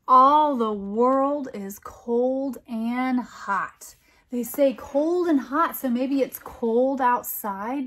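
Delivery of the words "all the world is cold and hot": slow, lilting picture-book reading; poem read aloud. A narrator's reading. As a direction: read it like a bedtime rhyme for kids. Poem refrain.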